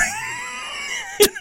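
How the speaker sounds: very high wordless scream; enraged screech. Retold or imitated. Man slamming his weed eater on a tree, enraged screech. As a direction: shriek in mock rage.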